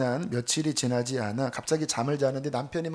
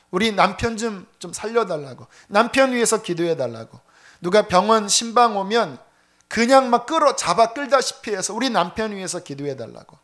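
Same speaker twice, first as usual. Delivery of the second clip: louder, higher, urgent pleading for prayer; desperate begging; 4 semitones higher, 6 dB louder than usual. Retold or imitated. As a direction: plead frantically for the husband. Wife begging others to pray. (간절하게 애원하는 목소리)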